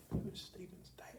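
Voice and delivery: hushed confused whisper. Retold or imitated. Puzzled man in the church lobby, whispering. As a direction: breathe the question under your breath.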